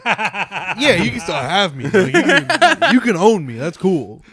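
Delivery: loud, high, laughing agreement; laughing in character. Acted. Slave answers cowboy in improv, amused agreement. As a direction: play along while cracking up.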